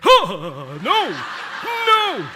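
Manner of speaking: loud, drawn-out wail of dismay; hammed-up howl of embarrassment; horrified cry. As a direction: cry out in comic horror, leaping high. Horrified at calling friend babe.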